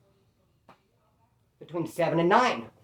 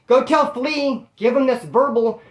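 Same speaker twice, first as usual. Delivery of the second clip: higher, insistent order to a messenger; relayed order; 5 semitones higher, 4 dB louder, slower than usual. Retold or imitated. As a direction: pass it on higher and slower, as an instruction. Retelling an inmate's called-out order.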